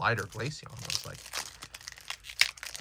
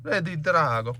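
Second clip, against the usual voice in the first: playful mock Italian accent, louder and higher; 6 semitones higher, 6 dB louder than usual. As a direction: say it like a hammy Italian. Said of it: "Italian type accent".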